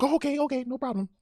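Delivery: very high, agreeable little-lady voice; high-pitched imitation of an eager woman; imitated old woman.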